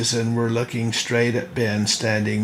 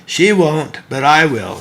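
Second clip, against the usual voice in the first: ordinary delivery, nothing marked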